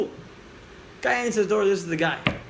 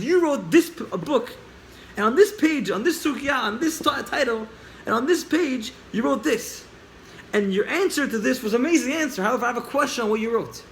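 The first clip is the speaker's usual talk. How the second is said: higher-pitched, insistent build-up; eager questioning; 5 semitones higher than usual. Retold or imitated. Boy quizzing the author on his book, eager and insistent. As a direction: press each point keenly, pitched up.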